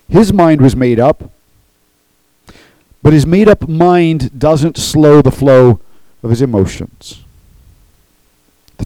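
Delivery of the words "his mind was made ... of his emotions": impassioned preaching. Acted. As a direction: drive it home from the pulpit. Preaching at full force.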